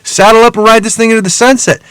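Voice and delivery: rally a buddy with rising hype; excited. Retold or imitated. Hustler as the money starts rolling in, excited cheering. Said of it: "I'm excited".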